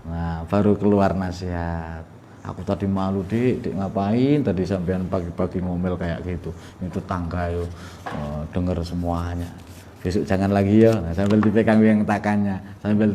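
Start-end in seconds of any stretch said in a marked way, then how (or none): 2.4-11.0 tender bedroom coaxing. Acted. Husband whispers advice in bed.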